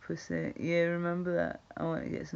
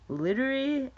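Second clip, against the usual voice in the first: put-on "literally" thrown up high and louder like a gushing valley girl; mock valley girl; 8 semitones higher, 6 dB louder than usual. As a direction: squeal "literally" in a higher, louder mock girly voice. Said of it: "why do lassies say that?".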